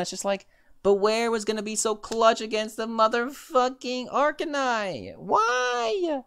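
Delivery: high, drawn-out wail ending in a squealed why; real wailing frustration; anguished lament. Real, not acted. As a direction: build to a very high, stretched cry of why. Lamenting lost Bewear.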